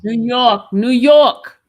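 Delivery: loud New York, New York; calling out the city proudly, loud; emphatic answer. Real, not acted. Loud answer naming her home, New York. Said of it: "You sound like Baltimore".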